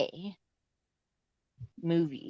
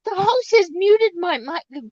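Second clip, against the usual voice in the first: loud high complaint, rising; 9 semitones higher, 5 dB louder than usual. Acted. Repeated, rising end.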